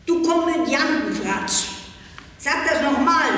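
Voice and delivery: lauter, viel höher, wütender Schrei. A narrator's reading. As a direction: schrei ihr die Beleidigung ins Gesicht. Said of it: "schrie ich". (das Mädchen schreit Pella wütend an)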